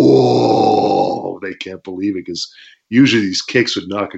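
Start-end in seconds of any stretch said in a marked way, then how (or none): 0.0-1.4 crowd whoa. Retold or imitated. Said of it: "the fans are going".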